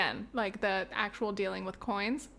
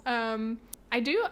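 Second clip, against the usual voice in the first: normal talking voice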